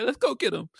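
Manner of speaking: high-pitched mock sob; lightly voiced sobbing gangster; tearful Arsenio. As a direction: squeak it out like a crying man. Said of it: "He's like".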